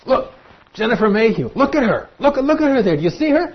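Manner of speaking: God showing off His redeemed people to the angels; proud God. Acted. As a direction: point him out to the whole court, thrilled. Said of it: "God is saying to the watching angels".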